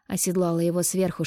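plain reading voice

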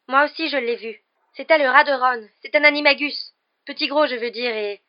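high, loud, earnest testimony; urgent insistence. A narrator's reading. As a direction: press to be believed. Girl urgently backs the boy's story. (insistance pressante)

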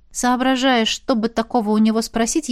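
ordinary delivery

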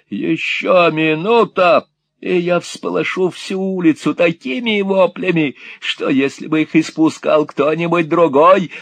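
put-on high, loud gloating old miser; vile sneer. A narrator's reading. Old Gride threatening young Nicholas, sneering high. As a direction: threaten with a nasty grin. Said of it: "воскликнул Грайд".

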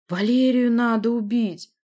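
loud, slow, high groaning command; drawn-out, insistent monster wail; monster's moan. Acted. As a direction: moan it out loudly. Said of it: "простонал Рык".

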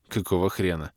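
usual speaking voice

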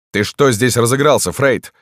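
high-pitched indignant call; mild yell. A narrator's reading. Dantes at the door to idle doctor, mildly yelling. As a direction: raise pitch, demand sharply from the door. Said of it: "завопил от двери Дантес".

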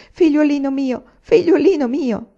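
high, loud tearful cry of joy; crying welcome. A narrator's reading. Old nurse weeping as she greets Anania at station, tearful loud cry. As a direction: weep it out as she greets him. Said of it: "cominciò a piangere".

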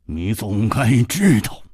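measured start rising to high, rapid menace; harsh commanding warning; stern threat. Acted. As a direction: threaten sharply, building in pitch. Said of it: "厉声说".

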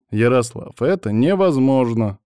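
ordinary delivery

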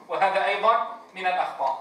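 usual speaking voice

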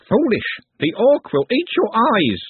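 high, drawn-out screechy warning; screeching bird creature. A narrator's reading. Bird-woman warning those by the couch, screeching.

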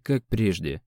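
ordinary delivery

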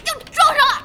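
high panicked yelps; panicked gaming. Acted. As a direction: flail at the game in panic. Boss panicking while gaming. (打游戏时惊慌大叫)

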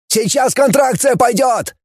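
high, agitated objection; protesting. A narrator's reading. Scientist refuses to leave before the contraction, agitated protest. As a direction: object urgently at the top of the range. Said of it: "воспротивился Тенин".

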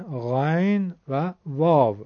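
ordinary talk, nothing marked

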